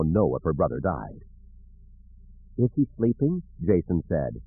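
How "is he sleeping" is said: lightly voiced child asking; high innocent child question; small child. A narrator's reading. Child asks about uncle.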